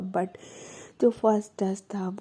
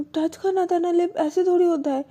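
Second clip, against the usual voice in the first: higher, louder petulant complaint; complaining; 8 semitones higher, 5 dB louder than usual. Acted. Wife protesting the kiss didn't happen, higher petulant whine. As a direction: whine that she was cheated of the kiss.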